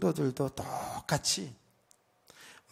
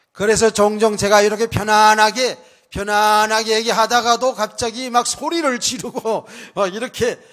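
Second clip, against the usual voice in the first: much louder and higher, full-force outburst; sudden preacher's shout; 6 semitones higher, 12 dB louder than usual. Real, not acted. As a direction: burst into shouting mid-sermon. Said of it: "소리 지르는 게".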